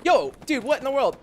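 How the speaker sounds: high, surprised exclamation; jolted by a fresh shock; startled. Real, not acted. Startled again by the monster.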